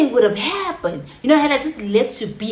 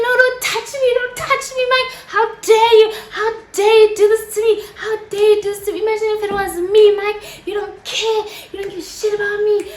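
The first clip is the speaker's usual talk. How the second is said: high-pitched, frantic acted outburst; tearful hysterics; 10 semitones higher, 3 dB louder than usual. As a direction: plead and accuse in a dramatic high wail. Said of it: "I'm in tears and I'm hysterical".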